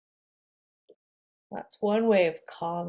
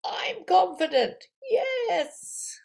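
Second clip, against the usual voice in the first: fired-up shout; 10 semitones higher, much slower than usual. Shown as a demonstration. After the power breath, teacher shouts fired up. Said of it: "This breath is gonna get you all fired up".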